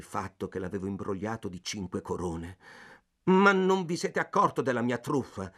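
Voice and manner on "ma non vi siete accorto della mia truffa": louder, agitated, out of breath; confessing the swindle to the clerk, impatient and breathless; impatient exclamation. A narrator's reading. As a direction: blurt it out impatiently. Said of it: "esclamai impaziente, respirando affannosamente".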